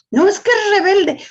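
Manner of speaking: mock mother, high-pitched whine; complaining mother. Preacher mimics a mother to the congregation, high mock complaint. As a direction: imitate a mother labelling her child rebellious. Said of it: "Yo les digo a la, las madres".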